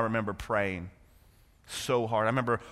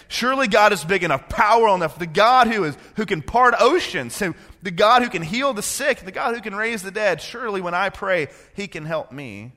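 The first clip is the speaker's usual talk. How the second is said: higher, louder pleading faith; fervent preaching; 8 semitones higher, 7 dB louder than usual. Acted. Pastor voicing believer's prayer, fervent. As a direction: build it like a pulpit plea.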